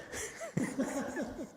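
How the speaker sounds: high breathy giggle; a soft high laugh. Real, not acted. After teasing, a soft high giggle.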